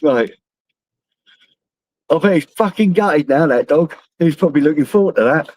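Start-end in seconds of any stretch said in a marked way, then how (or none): none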